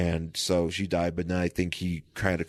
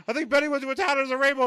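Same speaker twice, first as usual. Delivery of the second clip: high-pitched mock panic; frantic retelling; 3 dB louder, much faster than usual. Rick reporting where Betty went, high mock panic. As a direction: blurt it way up high, hammed-up alarm.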